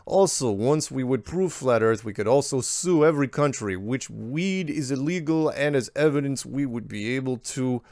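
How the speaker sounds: a hint of Croatian accent. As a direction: read the email in a mild Croatian accent. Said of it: "I'm going to read this now in the accent".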